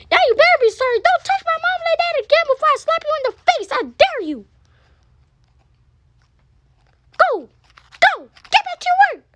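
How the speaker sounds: high, loud challenge to a fight; angry shouting son. Acted. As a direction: bark it out like a kid picking a fight. Son threatens his dad.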